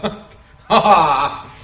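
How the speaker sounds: loud mock demon cackle; a hammed-up demonic ha ha; demon laugh. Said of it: "I love the way the demons talk".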